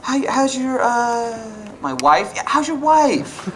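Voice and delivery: high, overly chummy coworker; high, slow mock schmooze; fake friendly coworker. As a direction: play the fake-friendly office guy. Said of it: "act like they know you".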